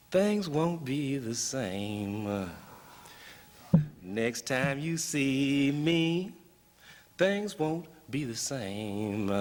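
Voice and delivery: slow, stretched bluesy phrasing; drawn-out blues recital. Acted. Poet quotes an old bluesman's lyric to the audience, drawn-out recital. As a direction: draw out each line like an old blues verse.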